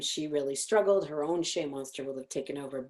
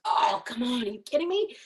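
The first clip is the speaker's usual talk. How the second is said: higher, exasperated outburst from a tired dad; higher mock exasperation, rising to a peak; 5 semitones higher than usual. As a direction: act out a dad fed up over spilled coffee. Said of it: "Dad goes".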